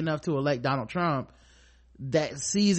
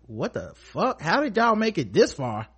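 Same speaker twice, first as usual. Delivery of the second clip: incredulous; 8 semitones higher, 4 dB louder than usual. Retold or imitated. Voicing disbelief at the video.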